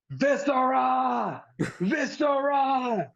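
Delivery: high, slow, drawn-out screaming call; mock scream. Shelton's mama screaming for Viscera on the mic, drawn-out wail. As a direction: stretch the name out like a wailing mom. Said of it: "she is just screaming".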